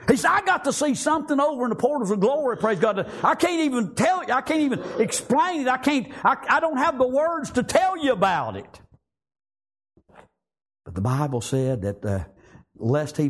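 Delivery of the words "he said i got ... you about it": high, tumbling, overawed testimony; excited preaching. Acted. Paul tells of seeing glory beyond words, excited.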